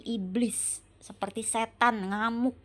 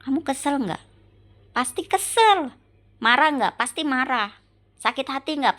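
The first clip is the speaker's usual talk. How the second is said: higher, louder, heated questioning; exasperated challenge; 4 semitones higher, 5 dB louder than usual. Real, not acted. Wife confronting husband over his night-time rampage, exasperated. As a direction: fire the questions out in rising exasperation.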